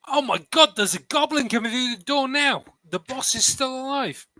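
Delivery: high, loud fake panic shout; mock alarm. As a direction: cry out as if a goblin burst in. Fake alarm to scare Wallace.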